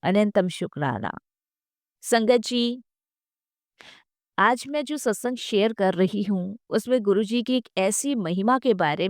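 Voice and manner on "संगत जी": ordinary talk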